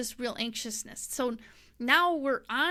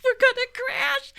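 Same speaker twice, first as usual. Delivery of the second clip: high, loud hammed-up panic through a laugh; imitating her own terror, much higher; mock terror; 10 dB louder than usual. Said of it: "so I'm thinking".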